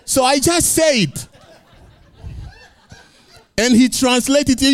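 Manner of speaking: normal talking voice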